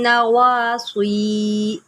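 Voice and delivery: नवासी with a long nasal hum on the noon; nasal ghunna. Shown as a demonstration. Teacher shows ghunna on नवासी. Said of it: "नून के ऊपर जो है आप गुन्ना करें".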